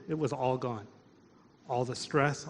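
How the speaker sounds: usual speaking voice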